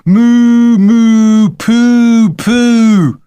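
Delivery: high, loud, long hoots; boy's gleeful jeering chant; shouted taunt. A narrator's reading. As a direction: bellow silly rhyming moos. Boy taunts the cow, shouting.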